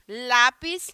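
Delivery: usual speaking voice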